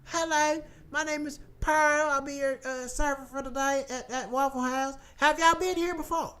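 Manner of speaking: put-on Southern accent, server introducing herself; Southern waitress accent. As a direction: greet a new table in Southern accent. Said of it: "they got the Southern accent".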